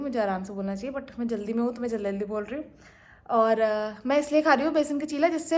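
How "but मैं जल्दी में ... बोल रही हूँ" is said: fast, hurried words; rushed talk. Real, not acted. Rushing to her viewers because she is late. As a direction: rattle it off in a hurry. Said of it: "मैं जल्दी जल्दी क्यों बोल रही हूँ".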